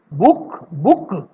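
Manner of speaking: बुक said slowly twice, stretched out; slow model word. Shown as a demonstration. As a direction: show the odd sound in बुक. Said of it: "इसकी sound अलग आ रही है". (बुक को धीरे धीरे दो बार बोलकर दिखाना)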